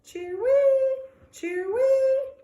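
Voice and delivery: high, loud cheer-wee call; sad bird call. Acted. The caged bird calling to the dog, high and loud. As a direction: chirp it out like a lonely bird. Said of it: "doesn't sound cheery".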